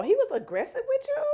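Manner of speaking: high disbelieving question; mock shock. Retelling the shocked question to the friend. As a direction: ask it stunned. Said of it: "So I was like".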